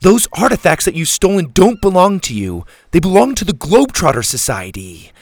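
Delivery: high, loud indignant accusation; forceful, angry girl; barking. A narrator's reading. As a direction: bark the accusation at him. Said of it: "Susie barked".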